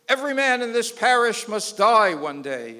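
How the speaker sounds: sermon voice from the pulpit; priest preaching. Retold or imitated. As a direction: preach it out to the parish.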